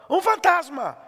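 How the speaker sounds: high-pitched acted cry of alarm; frightened cry. Preacher acting out disciples seeing a ghost, frightened cry. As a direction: call it out high, in mock terror.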